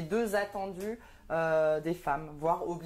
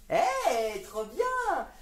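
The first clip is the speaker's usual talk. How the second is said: mock delight; 7 semitones higher, much slower than usual. Mock excitement at the headline.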